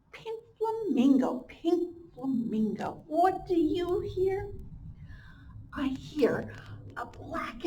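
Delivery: sing-song slow storybook read; reading to children. Acted. Teacher reads the refrain aloud to her class, slow and sing-song. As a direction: chant the refrain for little ones.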